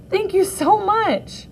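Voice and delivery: thrilled thanks to Chloe; overjoyed thanks. Acted. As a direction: let the gratitude soar up high and slow. Myra overjoyed at saved puppets.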